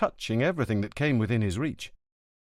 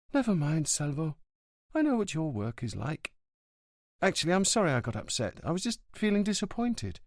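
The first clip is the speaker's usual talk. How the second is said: put-on girlfriend's voice; 7 semitones higher than usual. A narrator's reading. Livia on the phone to Montalbano, apologetic. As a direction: make up gently with him.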